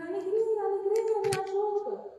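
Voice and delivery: loud, high desperate shout over blaring music; shouting for help. Acted. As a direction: yell out to be heard over a party boat. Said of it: "ele fica gritando". (gritando alto e agudo, desesperado, por cima da música)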